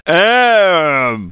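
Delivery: loud, high drawn-out yelp; hammed-up wordless yell; mock outburst. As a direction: blurt it out in a long high cry. Acting out husband's outburst.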